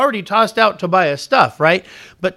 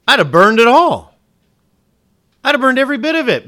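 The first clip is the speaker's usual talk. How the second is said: emphatic; 6 semitones higher, 9 dB louder than usual. Real, not acted. Preacher says what he'd do.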